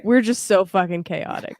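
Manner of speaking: usual speaking voice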